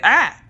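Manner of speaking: sudden high loud yelp of fright; startled scream. Retold or imitated. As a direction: scream like a kid jolted awake. Son wakes up startled.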